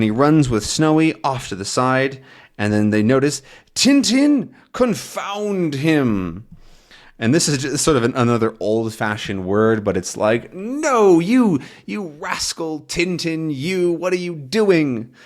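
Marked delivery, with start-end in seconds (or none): 3.7-6.5 high cry sinking into a slow curse; exasperated cursing. Acted. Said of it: "He's sort of swearing at him". 10.5-15.1 high, slow, drawn-out scolding; mock swearing. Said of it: "He's sort of swearing at him".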